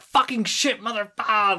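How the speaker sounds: high pained yelling of curses; swearing loudly. Retold or imitated. Retelling falling off her bike, shouting curses. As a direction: curse out loud like you just fell off your bike. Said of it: "swearing my head off".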